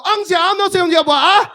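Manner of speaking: hammed-up fake Mandarin; fake Mandarin gibberish. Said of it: "But I now only speak Mandarin".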